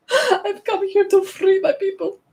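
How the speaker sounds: high, hammed-up Scottish accent. Proclaiming freedom for the people, mock Scottish accent. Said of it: "Even though I am part Scottish".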